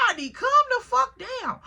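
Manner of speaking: high-pitched exasperated retort; retold exasperated plea. Retold quarrel in truck.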